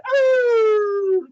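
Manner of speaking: very high, loud drawn-out "oh"; hammed-up high moan; blissful howl. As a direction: wail like a dog being scratched. Dude reacts to scratching, acted.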